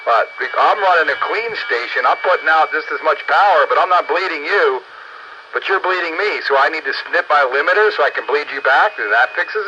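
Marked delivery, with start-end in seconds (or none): none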